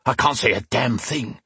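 mildly growling, frustrated thug; growled complaint. A narrator's reading. Blinded attacker complains to his partner, growling a little. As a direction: grumble it with a growl. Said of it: "the other man growled back".

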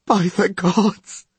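slow, high-pitched wail; sobbing. A narrator's reading. Terrified man calling on the gods, high sobbing. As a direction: sob it out in dread. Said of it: "sobbed Kevin R. Van Vliet".

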